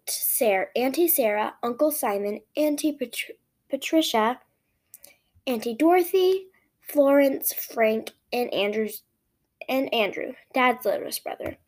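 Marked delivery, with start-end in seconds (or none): none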